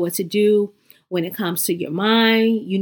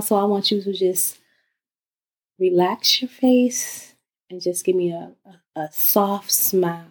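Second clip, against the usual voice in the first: guided meditation. Acted.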